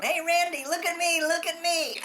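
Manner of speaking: put-on bouncy little-boy "look at me" call; excited kid showing off. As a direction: call out to your cousin like a nine-year-old daredevil. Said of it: "I was going".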